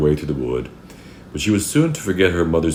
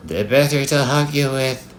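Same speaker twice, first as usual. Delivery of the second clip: high, emphatic fake-granny voice; wolf's high pretend old lady; wolf posing as Grandma; 11 semitones higher than usual. A narrator's reading. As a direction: offer a hug, disguised as Grandma.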